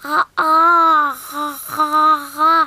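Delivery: talking with mouth open; mouth wide open. Real, not acted. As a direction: try to talk with jaw dropped. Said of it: "difficile de parler avec la bouche grande ouverte".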